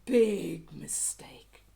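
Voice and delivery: slow, low, loud whisper of doom; hint at trouble ahead; ominous whisper. A narrator's reading. Ominous narrator warning.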